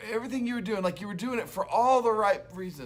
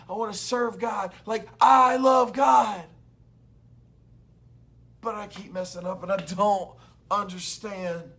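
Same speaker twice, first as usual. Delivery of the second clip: higher and louder, building to a shout; Paul crying out; 6 semitones higher, 7 dB louder than usual. Acted. Paul crying out to God about his struggle, an anguished loud plea. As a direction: plead like a man torn inside. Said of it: "Paul's crying out and he's saying".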